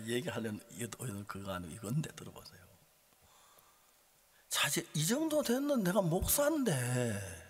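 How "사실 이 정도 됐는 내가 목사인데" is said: high, exasperated preacher's storytelling; worked-up pulpit voice; impassioned preaching. Acted. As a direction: preach the helpless dilemma with rising urgency. Preaches his dilemma at full voice.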